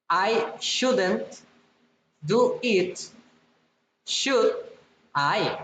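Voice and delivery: "should I" drops at the end; falling tag question. Shown as a demonstration. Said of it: "falling or rising intonation".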